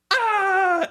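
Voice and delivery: high yelp of pain; injured man yelps as nail is pulled; pained cry. Retold or imitated. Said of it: "he goes".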